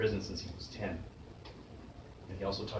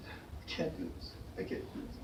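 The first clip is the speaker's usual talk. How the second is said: breathless whisper; 10 dB quieter than usual. Retold or imitated. Big kid breaking down in class.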